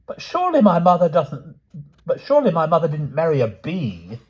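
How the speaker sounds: put-on doubting child's protest; boy's voice. Gareth doubts the tutor, high and incredulous.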